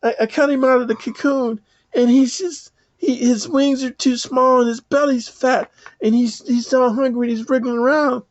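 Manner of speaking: put-on a worried child asking an old man for help; a little girl begging the old man for help. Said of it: "and she picks up the caterpillar and say".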